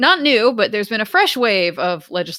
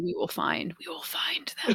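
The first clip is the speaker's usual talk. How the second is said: whispered playful threat; mock-ominous whisper; 10 dB quieter than usual. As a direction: drop into a hushed, creepy whisper. Mock-ominous promise to track listeners down.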